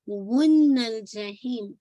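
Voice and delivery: वुन्नल जहीम slow, a loud held hum on न; complete nasal ghunna. Shown as a demonstration. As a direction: hold the double न of वुन्नल before जहीम. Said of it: "most complete गुन्ना है". (वुन्नल जहीम में पूरा गुन्ना, ऊँची आवाज़ से)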